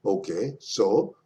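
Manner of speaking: normal talking voice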